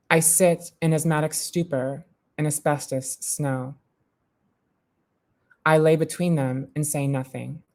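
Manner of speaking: measured, deliberate spoken-word poem; poem reading. Acted. Poet reading her work to a gallery audience, measured. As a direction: let each image land with pauses.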